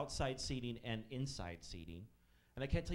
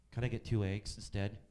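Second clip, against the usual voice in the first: lower, flat-toned mock customer; vague customer; 5 semitones lower than usual. Waiter imitating the woman asking for eggs, lower.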